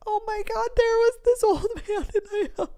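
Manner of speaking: high, mock-crying voice; hammed-up tearful explanation to a partner; imitated tearful blubbering. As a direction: blubber it out, half laughing. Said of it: "water running down my eyes".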